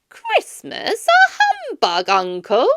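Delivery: very high, loud, disbelieving cry; can't believe his ears; astonished nephew. A narrator's reading. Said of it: "said Scrooge's nephew".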